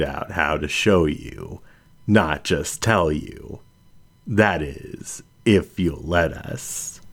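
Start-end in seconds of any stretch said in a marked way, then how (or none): none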